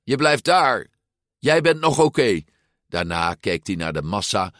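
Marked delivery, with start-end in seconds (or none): 0.0-2.4 urgent insistence. A narrator's reading. Urgently tells friend to stay.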